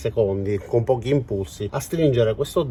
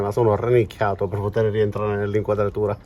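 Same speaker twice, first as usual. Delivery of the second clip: normal talking voice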